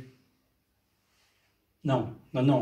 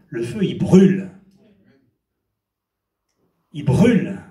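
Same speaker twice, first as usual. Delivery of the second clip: louder, hammering "brûle" down hard; loud preaching emphasis; 7 dB louder than usual. Real, not acted. Preacher warning the congregation that revival fire burns, loud emphatic. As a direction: warn them, loud and heavy, that fire burns.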